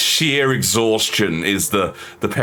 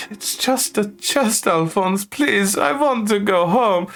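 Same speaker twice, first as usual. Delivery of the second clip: pleading parent; 12 semitones higher, slower than usual. Acted.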